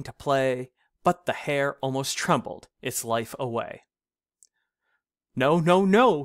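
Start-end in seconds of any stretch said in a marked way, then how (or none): 5.3-6.2 loud, higher panicked protest; wild protesting. Acted. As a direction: protest in a fright. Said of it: "he cried, in wild protesting".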